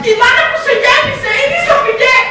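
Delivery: high, loud declaration; dramatic revelation. Acted. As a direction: drive the secret home over all protest. Blurts out the true birth mother.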